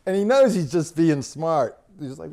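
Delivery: normal talking voice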